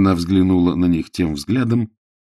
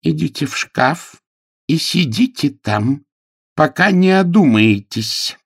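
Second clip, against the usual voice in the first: stern scolding nanny; 9 semitones higher, 5 dB louder than usual. Acted. Nanny sends him to the cupboard, sternly. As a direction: banish a naughty child, voice raised.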